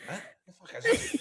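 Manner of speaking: very high, laughing incredulous squeak; mock baffled footballers. Imitating the baffled legends watching wrestling, high and laughing. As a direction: play the bewildered old pros. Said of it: "looking at me going".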